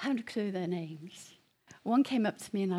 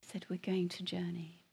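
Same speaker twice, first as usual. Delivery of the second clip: hushed whisper; 6 dB quieter than usual. Real, not acted. Recalls the voice that walked with him.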